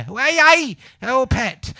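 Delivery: high, loud mock Geordie "hey, hey, oh pet"; Geordie impression. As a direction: put on a Geordie lilt for pet. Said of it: "did you just do an impression of me?".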